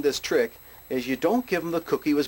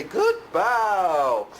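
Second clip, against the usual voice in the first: dog praise; 6 semitones higher than usual. Shown as a demonstration. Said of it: "give 'em a treat and say".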